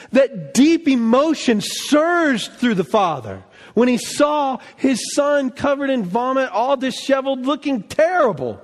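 ordinary talk, nothing marked

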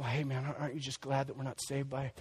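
lightly playing a friend shrugging off sin; mock breezy reassurance. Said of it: "we start preaching the gospel".

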